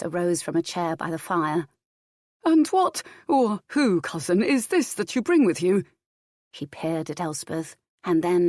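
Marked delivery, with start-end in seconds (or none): 2.4-5.8 higher-pitched, emphatic questioning prior; a prior's raised-pitch query to his cousin; inquisitive prior. A narrator's reading. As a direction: open high and louder, questioning the visitor's company.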